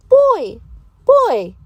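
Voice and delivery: put-on eager child repeating "alligator"; kids repeating. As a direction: squeal it back like a small pupil. Pretend pupils repeat the word.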